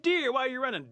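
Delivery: very high, very loud question; frantic shouted call. Acted. Animals catching up with Squirrel, hollering urgently. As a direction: holler after someone running off.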